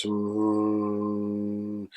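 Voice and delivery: long steady closed-lip hum; a held meditative mm; sustained hum. Shown as a demonstration. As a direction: hum "mm" evenly through the breath. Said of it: "the mm comes in".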